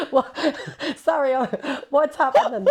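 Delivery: laughing along at her friend's laughter. Real, not acted. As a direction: ask it while cracking up.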